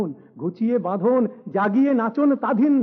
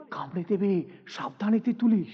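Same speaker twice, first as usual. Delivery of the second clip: softer, cautious aside; hushed warning; 3 semitones lower, 6 dB quieter than usual. A narrator's reading. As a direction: warn them under your voice. Bystander warns others in hushed voice. (নিচু গলায় সাবধান করা)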